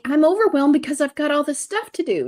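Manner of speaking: high, loud, fast complaining rush. Retold or imitated. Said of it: "I'm like".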